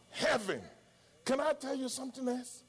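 normal talking voice

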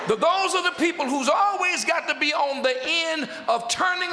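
high, driving preacher's delivery; insistent pulpit cadence; forceful preaching. Real, not acted.

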